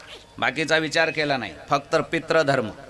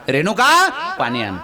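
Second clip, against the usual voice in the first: calling out; 8 semitones higher, 5 dB louder than usual. Acted. Husband calls wife again at dawn.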